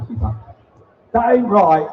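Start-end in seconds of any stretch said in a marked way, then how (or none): 1.1-1.9 loud, higher mock cockney wife, "That ain't right" in broad cockney; hammed-up cockney wife voice. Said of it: "She's a cockney".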